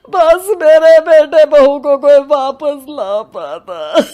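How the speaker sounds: put-on old father sobbing out a wish; crying. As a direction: wail it out as the tears come. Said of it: "फूट फूट कर रोना शुरू कर देते हैं".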